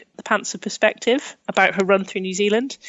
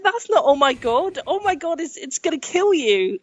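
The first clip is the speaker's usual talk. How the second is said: higher, indignant mock outrage; exasperated panic; 7 semitones higher than usual. Scolding the friend over lost signal, higher, exasperated mock panic. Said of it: "I was like".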